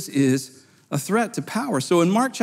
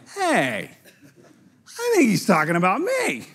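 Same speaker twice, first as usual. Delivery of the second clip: lightly voiced leader catching on, with a laugh; amused, half-laughing aside; mock realization; 3 semitones higher than usual. Leader realizes parable targets him.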